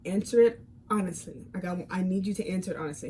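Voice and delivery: soft, steady and serious; calm and quiet. Retold or imitated. Asking her boyfriend for honesty, calm and quiet. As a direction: hold it quiet and measured. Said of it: "I'm talking calm just like this".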